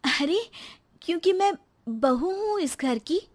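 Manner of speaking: put-on young wife Rekha. New bride answers her husband, a touch hurt.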